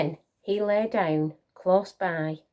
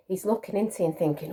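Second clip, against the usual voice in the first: usual speaking voice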